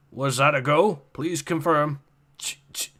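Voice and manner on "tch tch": mouth static clicks. Acted. Radio static imitated.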